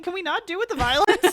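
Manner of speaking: high, loud whiny protest; whiny teen voice. Retold or imitated. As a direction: whine it like a teen. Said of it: "he was like".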